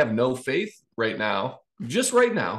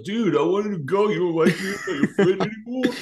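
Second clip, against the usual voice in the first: whiny coworker; 7 semitones higher than usual. Retold or imitated. Coworkers complaining about missed outing.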